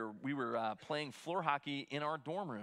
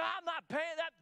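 much higher, agitated mock rant; retold angry yelling; 12 semitones higher than usual. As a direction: roommate refusing to pay, hammed-up angry rant. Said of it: "he's yelling and screaming".

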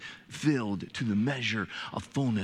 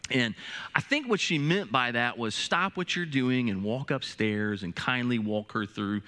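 usual speaking voice

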